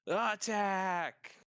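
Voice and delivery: loud, high rallying cry; battle shout. Acted. Glinda calling an attack on seeing prisoners fall, loud shout. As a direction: shout the charge at full voice. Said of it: "shouts out".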